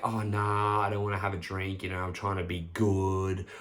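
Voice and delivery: half-hearted, wishy-washy brush-off; willy-nilly refusal. Retold or imitated. Drinker feebly turning friends down, willy-nilly. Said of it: "you saying that willy-nilly".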